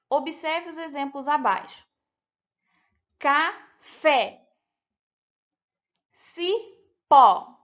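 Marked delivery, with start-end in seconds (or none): 3.2-4.5 "Café" split in two, loud on "fé"; stress on last syllable. Shown as a demonstration. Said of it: "todas as últimas sílabas foram pronunciadas mais forte". 6.1-7.6 "Cipó" split apart, "pó" much louder; stress on last syllable. Shown as a demonstration. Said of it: "todas as últimas sílabas foram pronunciadas mais forte".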